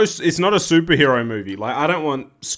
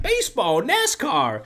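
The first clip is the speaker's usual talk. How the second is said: high, drawn-out boast; over-the-top American; 8 semitones higher, slower than usual. Acted. Comedian voicing the clueless American, over the top. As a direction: crow it high, lacking all self-awareness.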